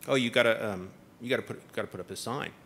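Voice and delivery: low, droning official; mock building inspector. Retelling the inspector's order, in a low droning mock voice. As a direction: sink low like a bored inspector. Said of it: "the building inspector said".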